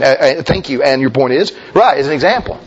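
ordinary talk

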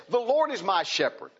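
proud, boasting declaration; confident boast. Shown as a demonstration. Pastor showing David's boast to the church, proud and confident. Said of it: "That's a confident boast".

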